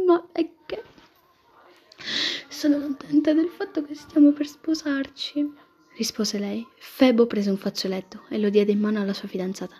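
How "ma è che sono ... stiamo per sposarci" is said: high, slow emotional reply; moved. A narrator's reading. Bride-to-be tells her fiancé she is happy to marry, moved. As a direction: speak as if moved to tears, pitched high. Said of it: "l'abbracciò commossa".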